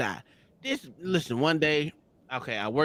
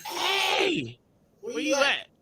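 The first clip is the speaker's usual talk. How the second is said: calling out; much slower than usual. Retold or imitated. Calling out on entering house.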